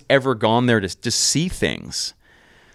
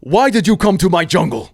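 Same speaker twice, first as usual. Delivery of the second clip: higher, louder, put-on voice of a suspicious governor; hostile governor; 9 semitones higher, 7 dB louder than usual. Governor demanding why the tourists came, imitated in a high, loud put-on voice. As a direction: confront the intruders in your jungle. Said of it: "And he goes".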